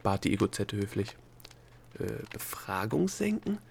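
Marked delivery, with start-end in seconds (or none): 2.0-3.6 slow, soft, puzzled question; slow-witted. A narrator's reading. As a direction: repeat it as if not catching on. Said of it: "wiederholte Delia begriffsstutzig".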